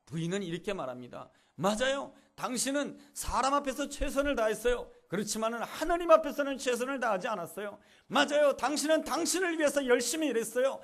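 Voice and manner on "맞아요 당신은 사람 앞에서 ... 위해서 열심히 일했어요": high, loud rebuke; scolding. Retold or imitated. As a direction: scold him firmly, voice raised. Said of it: "꾸짖듯이 말합니다". (꾸짖는 말투, 높고 크게)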